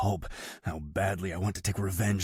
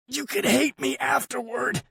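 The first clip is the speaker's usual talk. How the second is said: high, strangled plea; choked; 4 dB louder than usual. A narrator's reading. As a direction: squeeze it out choking. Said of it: "Yuri chokes".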